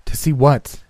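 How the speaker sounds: high, loud frightened outburst; terrified cry. Acted. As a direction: cry out in horrified curiosity. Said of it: "he cried".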